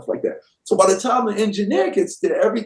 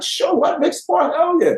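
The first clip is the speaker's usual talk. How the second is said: high-pitched, retold eager yes; excited agreement; 11 semitones higher than usual. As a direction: act out jumping at the chance, voice shooting up. Acting out his own eager reply.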